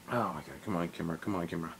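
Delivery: low, soft, fed-up grumble; under the breath, annoyed with the camera; quiet exasperated mumble at a gadget; muttering at the camera. Real, not acted. Camera fails while filming.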